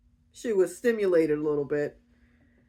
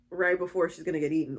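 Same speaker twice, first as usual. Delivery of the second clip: ordinary talk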